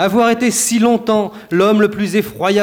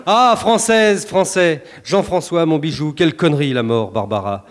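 ordinary delivery

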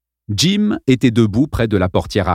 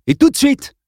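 much higher, firmer; insistent; 4 dB louder than usual. A narrator's reading. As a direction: snap it out. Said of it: "ajouta-t-il".

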